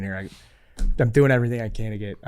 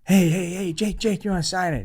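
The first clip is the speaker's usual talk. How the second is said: higher, eager, pleading fan; pushy autograph hunter; 6 semitones higher than usual. Retold or imitated. Forty-year-old fan pestering Jake for an autograph, eager and pleading. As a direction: chase him down for a signature.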